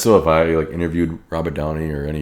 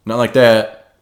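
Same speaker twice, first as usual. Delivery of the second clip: snapped loud and higher, like a scolding chef; mock harsh correction; 6 semitones higher, 12 dB louder, much slower than usual. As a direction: bark it like a bossy cook cutting you off. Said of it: "but don't be like".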